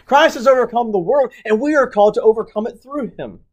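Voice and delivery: high and loud, rousing the flock; fervent preaching. Real, not acted. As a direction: proclaim victory with fire. Preaching at full force.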